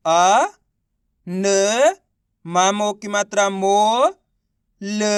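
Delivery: अ, न, मो, ल each held long and set apart; spelling out syllables. Shown as a demonstration. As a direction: sound out अ, न, मो, ल slowly, pausing after each. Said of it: "एक एक अक्षर का उच्चारण". (अ, न, मो, ल को अलग-अलग खींचकर बोलना)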